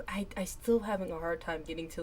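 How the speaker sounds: ordinary talk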